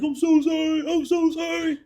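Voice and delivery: high, whiny imitation of crying; mock tearful apology. Imitating the boy after he cursed, high mock crying. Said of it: "he just started crying".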